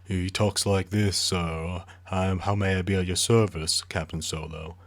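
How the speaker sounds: unhurried droid drawl, politely offering service; Bollux's leisurely drawl. Acted. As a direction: put on a leisurely, measured drawl. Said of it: "he has, like, this leisurely drawl".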